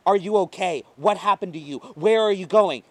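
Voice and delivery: put-on frantic woman questioning an injured girl; anxious rapid questions. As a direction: fire the questions at her, alarmed. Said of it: "shooting questions left and right".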